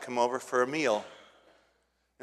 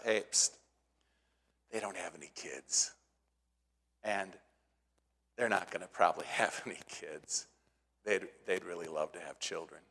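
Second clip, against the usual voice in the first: hushed, much softer servant aside; confiding aside; 7 semitones lower, 11 dB quieter than usual. Acted. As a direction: lean in and tell the secret softly. Servant Gehazi confides to Elisha.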